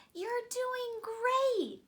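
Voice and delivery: high, slow, bright cheer; encouraging moon. A narrator's reading. The moon cheering on the first airplane, high and slow. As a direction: coax them upward like a proud friend. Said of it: "encouraged the moon".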